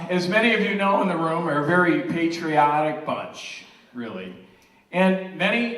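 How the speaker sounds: loud, proud toast to the room; rally the room with pride; father of the bride speech. Real, not acted. Toasting family members at the wedding.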